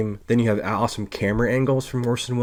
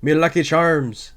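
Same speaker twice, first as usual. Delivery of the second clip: higher, hammed-up Irish brogue; mock the Irish accent like a cereal mascot; exaggerated stage Irish; 5 semitones higher than usual. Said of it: "Orson Welles' accent in this, the Irish".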